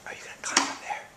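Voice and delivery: whispering. Real, not acted. Parent whispering to toddler on the furniture, hushed whisper. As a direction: keep it secret, whisper so nobody hears. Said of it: "keep it quiet".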